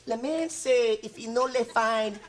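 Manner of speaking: mock French gibberish; fake foreign language. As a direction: fake French accent, le on every word. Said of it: "Stop saying that".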